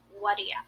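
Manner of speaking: "What do ya?" run together, do cut to a quick d-sound; relaxed "What do ya?" with the vowels reduced. Shown as a demonstration. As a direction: say "What do ya?" with you shrunk to a weak ya. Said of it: "which we say as".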